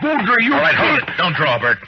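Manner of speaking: sharp loud shout to stop a draw; shouted warning. Acted. Marshal shouts to stop a man from drawing, loud commanding shout. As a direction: yell it out before anyone fires.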